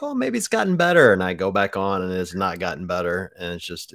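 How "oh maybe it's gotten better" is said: high, loud hopeful self-quote; mock hopeful. Going back on Reddit, mock hopeful. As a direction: play your past self talking yourself into hoping. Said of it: "Then I'm like".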